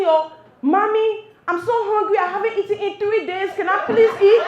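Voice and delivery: put-on loud, high-pitched pleading child; shouting little boy. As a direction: play a kid loudly begging mom for food. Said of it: "the little boy starts shouting".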